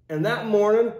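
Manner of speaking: ordinary delivery, nothing marked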